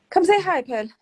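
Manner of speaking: high, loud call to come over. Real, not acted. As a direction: call her over to join the live. Calling sister to join live.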